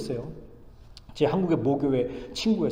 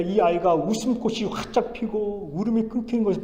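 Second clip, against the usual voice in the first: loud, high-pitched sermon declaration; impassioned preaching; 5 semitones higher, 5 dB louder than usual. Real, not acted. Preacher proclaiming God's promise to the congregation, impassioned. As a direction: preach the promise with full conviction. Preaches power of Jesus' name.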